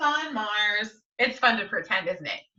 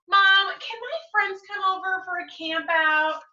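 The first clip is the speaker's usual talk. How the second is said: put-on eager child; 7 semitones higher than usual. Child asking mom eagerly.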